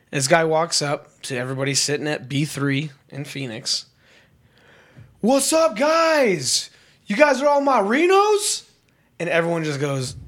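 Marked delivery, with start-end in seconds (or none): 5.2-8.6 high, rowdy mock drunk greeting; drunk guy. Drunk traveler hails strangers at the gate, high mock-drunk holler. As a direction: imitate an overfriendly drunk, pitched way up. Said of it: "Guy was obviously intoxicated".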